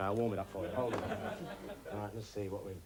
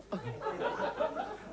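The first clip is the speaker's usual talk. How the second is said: very high squealing laugh. Acted. Laughs after silly judge voice.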